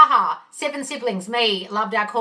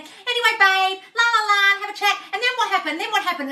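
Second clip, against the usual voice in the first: mock chatting parents; 9 semitones higher than usual. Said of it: "her and her husband would stand there".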